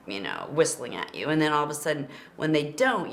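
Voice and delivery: ordinary delivery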